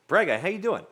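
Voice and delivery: ordinary delivery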